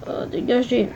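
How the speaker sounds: ordinary talk, nothing marked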